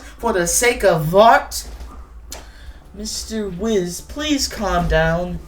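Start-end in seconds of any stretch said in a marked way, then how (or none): none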